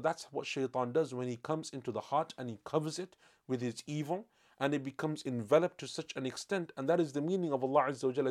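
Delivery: normal talking voice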